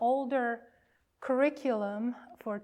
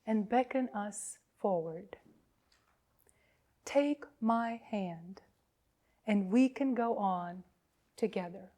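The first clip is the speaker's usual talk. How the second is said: slow, solemn liturgical reading; call to worship; 3 dB louder, slower than usual. Acted. Minister calling the congregation to worship, solemn and slow. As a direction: invite the congregation in gently.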